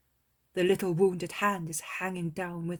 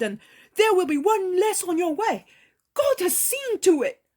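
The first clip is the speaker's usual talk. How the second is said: high, loud, scornful outburst; a disciple raging that the miracle is wasted; indignant Peter; 13 semitones higher, 12 dB louder than usual. Acted. Peter protests to Jesus.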